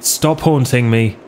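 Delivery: normal talking voice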